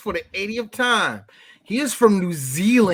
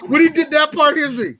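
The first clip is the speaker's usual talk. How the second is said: cracking up while repeating the line; laughing; 8 semitones higher than usual. Real, not acted. Friends replaying a ridiculous movie scene, laughing through the words.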